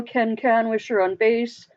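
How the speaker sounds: usual speaking voice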